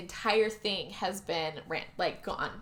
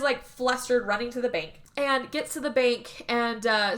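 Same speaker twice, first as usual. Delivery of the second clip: ordinary delivery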